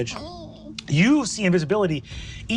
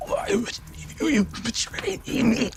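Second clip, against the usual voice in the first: dying plea; 9 semitones higher than usual. Acted. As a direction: ask it while bleeding out. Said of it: "as she goes".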